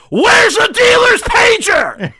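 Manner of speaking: drawn-out, very high full-throated scream; Sam's scream. Retold or imitated. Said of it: "it's not so much the screaming part".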